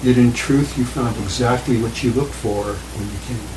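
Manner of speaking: normal talking voice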